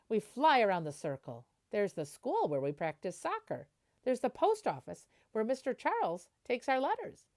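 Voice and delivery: gentle storybook reading voice; picture book narration. Acted. Teacher reading a picture book to the class, storybook voice. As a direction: read it like a bedtime story.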